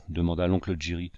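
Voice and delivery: usual speaking voice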